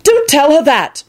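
high, drawn-out vehement objection. A narrator's reading. Neville defending Elizabeth to the warlock, vehement. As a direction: push back hard on the order. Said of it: "Neville said vehemently".

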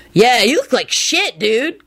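mild mock holler of a rude kid; rude slacker holler. A narrator's reading. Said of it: "Kenny hollered out rudely".

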